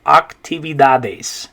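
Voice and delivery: actividades as ahk-tee-bee-DAH-des; Spanish pronunciation. Shown as a demonstration. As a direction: model actividades for learners. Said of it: "the way you're gonna say".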